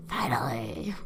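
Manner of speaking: very low, drawn-in villain voice; captor's voice. Acted. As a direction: put on a deep, menacing captor. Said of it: "That's how he talks".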